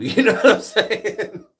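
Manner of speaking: words pushed out through a big laugh; cracking up mid-question. Real, not acted. Telling the chat his story, laughing hard.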